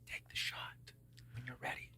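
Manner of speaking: hushed into the ear, barely voiced; whispered. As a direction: whisper it right into the shooter's ear. Guide leans in to his ear.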